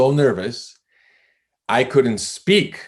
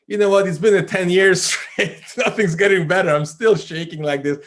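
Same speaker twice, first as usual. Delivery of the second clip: exasperated outburst; 8 semitones higher than usual. Retold or imitated. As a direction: vent years of frustration. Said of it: "I'm like".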